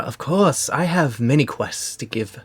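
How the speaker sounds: high, theatrical, delighted host; flamboyant mayor voice. Acted. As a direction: gush over the guests. Mayor continues in voice.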